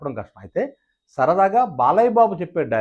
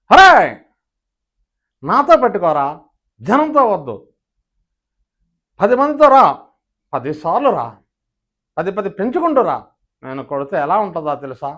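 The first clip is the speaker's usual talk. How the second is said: Krishna impression; 2 semitones higher, 6 dB louder than usual. Mimic doing superstar Krishna's version, loud and punchy. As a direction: fire it off like a superstar. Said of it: "ఇదే dialogue super star Krishna గారు కానీ చెప్తే".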